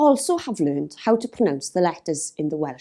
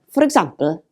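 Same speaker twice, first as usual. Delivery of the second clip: ordinary delivery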